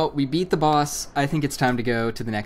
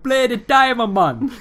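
high, loud laid-back uncle voice; mock island uncle; 12 semitones higher, 9 dB louder than usual. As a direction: ham up a chill island uncle. Echoing the Uncle Tito voice.

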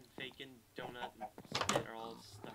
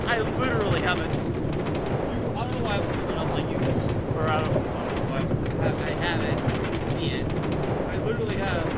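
loud, frantic yelling; panicked yelling; 5 semitones higher, 14 dB louder than usual. Real, not acted. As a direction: shout it in full panic. Panicking over spider in computer.

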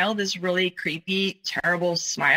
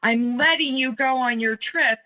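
raised pitch, quoting an indignant shout; retold yell; 6 semitones higher than usual. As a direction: imitate him yelling at a contained volume. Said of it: "he was yelling".

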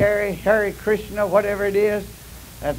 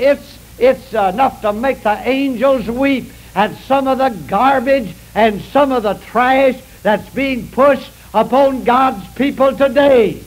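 slow, louder and rising pulpit cry; preaching at full force; 3 semitones higher, 4 dB louder, slower than usual. Acted. Preacher thundering at the flock about false teaching, fervent. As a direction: drive it home to the church.

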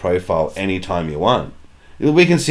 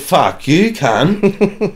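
pitched-up mock yell at a mate; mock outraged yell; 7 semitones higher, much slower than usual. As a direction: fire it back, half joking. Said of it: "I said".

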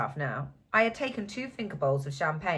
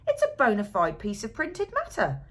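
triumphant; 6 semitones higher, 4 dB louder than usual. A narrator's reading. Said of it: "he cried triumphantly".

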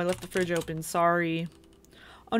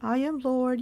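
ordinary delivery

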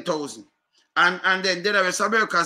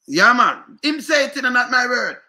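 emphatic outburst; 6 semitones higher, 7 dB louder than usual. Real, not acted. Heated insistence on a quote.

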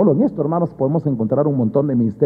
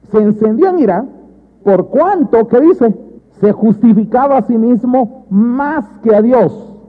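raised, deliberate proclamation; emphatic scripture reading; 5 semitones higher, 4 dB louder than usual. Acted. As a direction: declaim the verse to the congregation.

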